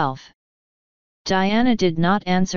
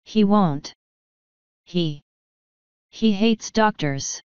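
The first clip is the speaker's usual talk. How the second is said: slow hesitant; slower than usual. A narrator's reading. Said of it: "Diana said slowly".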